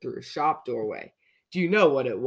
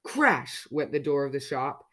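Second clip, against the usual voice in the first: plain reading voice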